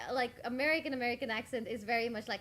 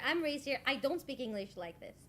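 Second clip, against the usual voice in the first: normal talking voice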